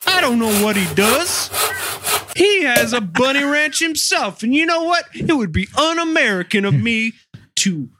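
high, put-on shopkeeper, shrugging it off; shopkeeper's voice. Host voices the pet shop owner excusing the daily bunny sales, high and put-on. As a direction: play the rabbit seller who sees no problem.

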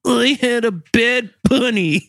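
high, slow put-on voice for the killer; killer's excuse. Host voices the killer telling his father about the bunny, high and slow. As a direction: say it slow and high, as if it were nothing.

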